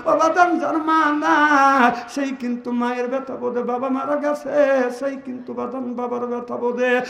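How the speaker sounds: sung, surging sermon chant; preaching at full force. Acted. Preacher telling Fatema's death to the gathering, chanting. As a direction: chant the story to the crowd.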